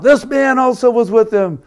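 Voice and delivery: sudden high, loud accusing outburst; a servant girl blurting an accusation at Peter. Retold or imitated. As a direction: blurt it out like you just recognised him. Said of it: "And then she blurts out".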